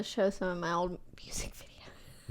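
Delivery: soft unvoiced whisper. Real, not acted. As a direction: whisper it, embarrassed. Embarrassed aside.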